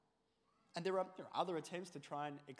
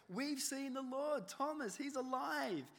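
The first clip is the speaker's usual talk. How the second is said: higher, slow, overjoyed insisting; excited disciples; 7 semitones higher than usual. Acted. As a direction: tell Thomas the wonderful news. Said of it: "they kept saying it".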